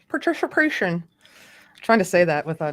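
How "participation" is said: lightly attempted Scottish accent, higher pitched; a loose stab at a Scottish accent; Sean Connery. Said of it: "Trying to sound like Sean Connery".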